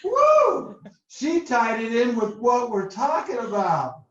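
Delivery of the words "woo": high, loud "woo" of delight; woo-hoo style cheer, pitched way up; excited whoop. Real, not acted. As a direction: let out a big cheering whoop. Host cheers a participant's quote.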